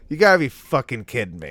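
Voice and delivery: loud, furious outburst. Acted. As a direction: blow up at the drive-thru guy. Repeating the outburst.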